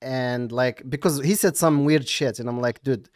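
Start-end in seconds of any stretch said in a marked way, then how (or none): none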